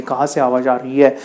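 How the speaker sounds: ordinary delivery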